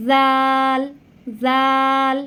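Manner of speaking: জাল with tongue tip peeking out, strong; জাল said slowly, the tongue tip just between the teeth; Arabic dhal. Shown as a demonstration. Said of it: "জিহ্বাটা সামান্য একটু বেরিয়ে আসবে".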